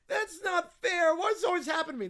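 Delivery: mock self-pitying kid whine; whiny kid. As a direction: wail the unfairness like a child. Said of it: "peak whiny kid territory".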